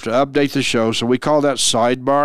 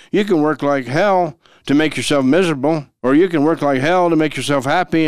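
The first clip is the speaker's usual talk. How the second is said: normal talking voice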